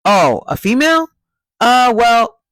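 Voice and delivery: high loud stammering caricature; mocking Adam. Adam stalling on the question again, hammed-up stammer. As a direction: ham up a caught-out dodge. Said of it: "Adam goes".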